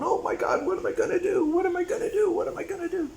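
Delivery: high, frantic mock panic. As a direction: ham up a flustered captain losing it. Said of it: "running around going".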